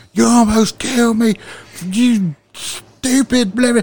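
high, loud furious yell; screaming. Retold or imitated. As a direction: scream at them, drawing it out slowly. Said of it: "and I'm screaming".

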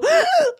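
sharp high gasp; imitated high-pitched gasp of shock; startled gasp. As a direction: gasp in sudden fright. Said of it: "I go, just go".

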